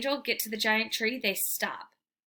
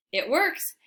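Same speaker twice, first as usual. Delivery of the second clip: ordinary delivery, nothing marked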